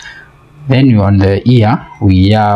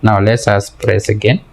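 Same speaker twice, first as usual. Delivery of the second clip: ordinary talk, nothing marked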